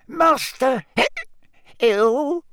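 high, slow, hiccuping elf; slurred high elf with hiccups; drunk house elf. A narrator's reading.

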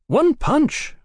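high-pitched gloat. A narrator's reading. Brother relishing the knockout, gloating. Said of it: "Brian gloated".

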